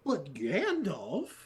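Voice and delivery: incredulous. Retold or imitated. Rising disbelief rant.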